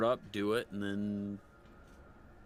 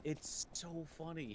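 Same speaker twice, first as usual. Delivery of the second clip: usual speaking voice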